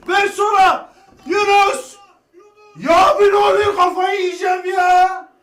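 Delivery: high loud exasperated yell; frustrated shout. Real, not acted. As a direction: lose it at the players. Frustration at teammates.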